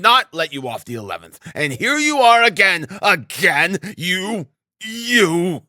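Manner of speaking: high, hectoring tirade; angry berating. A narrator's reading. Assistant superintendent berating the landlady, furious. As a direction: scold her like an outraged official.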